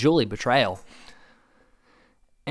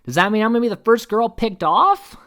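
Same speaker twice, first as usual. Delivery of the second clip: high, loud imitation of indignation; hammed-up outraged protest, pitched up; mock upset; 8 semitones higher, 5 dB louder than usual. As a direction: wail the complaint, rising at the end. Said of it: "she's upset".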